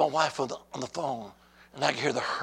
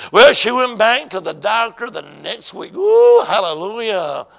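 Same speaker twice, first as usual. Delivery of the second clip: exultant preaching; 3 semitones higher, 9 dB louder than usual. Real, not acted. Preacher tells church of next visit, loudly exultant. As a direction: belt it out, stretching the ooh.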